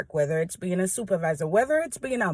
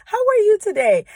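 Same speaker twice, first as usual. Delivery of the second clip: high, loud, bright mock greeting from a passerby; cheery greeting; 13 semitones higher, 9 dB louder, faster than usual. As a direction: imitate a cheerful hello from someone. Someone casually greeting her.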